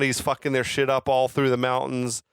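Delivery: normal talking voice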